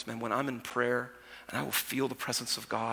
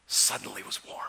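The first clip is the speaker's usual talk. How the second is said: hushed whisper; 6 semitones lower, 4 dB quieter than usual. Acted. Tender climax of parable.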